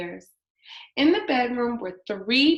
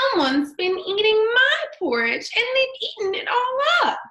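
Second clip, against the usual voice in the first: put-on high squeaky baby bear, much higher and louder; teeny tiny voice; 12 semitones higher, 5 dB louder than usual. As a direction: cry out as the littlest bear. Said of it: "he cried in his teeny tiny voice".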